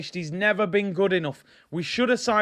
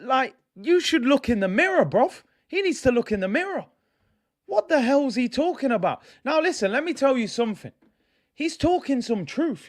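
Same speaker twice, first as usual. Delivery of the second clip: heated rant; 7 semitones higher than usual. Real, not acted. Fan venting at the captain's comments, fired-up angry rant. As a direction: tear into him like he should know better.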